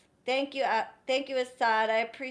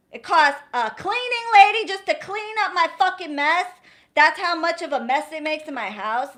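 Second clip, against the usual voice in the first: higher and louder, exasperated; heated rant; 4 semitones higher, 7 dB louder than usual. Real, not acted. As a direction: vent about cleaning up their mess. Ranting, voice up about mess.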